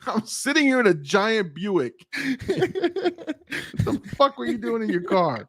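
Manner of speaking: high-pitched mock outrage; half-laughing, disbelieving mock yell; retold outburst at sister. Telling his sister off after the crash, high mock outrage. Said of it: "I'm yelling at my sister".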